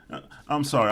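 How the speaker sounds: normal talking voice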